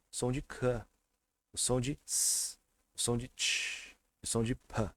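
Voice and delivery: slow, with each named sound released bare; isolated consonant sounds. Shown as a demonstration. Teacher reviewing the endings, isolated consonant sounds. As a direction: draw out the sound of K, S, T, P. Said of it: "Agora vocês podem ver".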